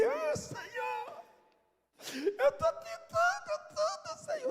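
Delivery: very high, slow pleading "Ah, Senhor"; mock whining prayer. Preacher mimicking believers begging God, high and whiny. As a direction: whine at God like a tired child.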